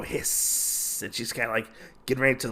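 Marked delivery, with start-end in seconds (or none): 0.0-1.0 long voiceless hiss; snake-woman's hiss. Acted. Woman about to lunge at Usagi and Gin, hissing. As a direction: draw out the sibilant like a snake. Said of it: "we hear the woman go".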